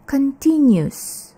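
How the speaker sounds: CONTINUOUS shortened to con-TIN-yus; mispronounced continuous. Shown as a demonstration. Teacher shows a frequent mistake, mispronounced. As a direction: squash the ending of CONTINUOUS into yus. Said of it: "Seringkali kata ini diucapkan".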